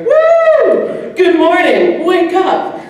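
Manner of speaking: high, loud, whooping morning holler; excited, high-pitched imitation of a wake-up call; grandmother's wake-up call. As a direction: whoop and sing out a rousing wake-up.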